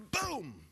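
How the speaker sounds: high, drawn-out mock boom; hatchet impact. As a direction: voice the chop as a falling boom. Imitates the hatchet coming down.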